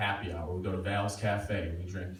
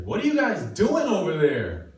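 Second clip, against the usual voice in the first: 1 annoyed neighbor yelling; 8 semitones higher, 8 dB louder than usual. Retold or imitated. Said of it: "she's like".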